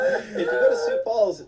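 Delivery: high squeal of laughter over the words; laughing high. Real, not acted. Cracking up at a joke, high laughing words.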